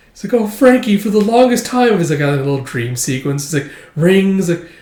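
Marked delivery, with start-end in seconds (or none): 0.3-2.0 swooning woman. Retold or imitated. Dream-sequence woman swooning.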